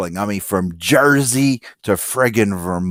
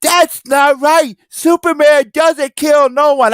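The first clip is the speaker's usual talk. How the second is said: put-on much higher, slow, louder kid's whine; little boy voice; 5 dB louder, slower than usual. Little boy in the theater objecting to Superman, high whiny kid voice. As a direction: play the small boy who's upset about Superman. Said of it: "He goes".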